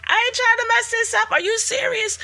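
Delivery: very high, squeaky mock disbelief; incredulous protest. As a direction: protest way up high, as if it's absurd. Mock incredulous reply to God's question.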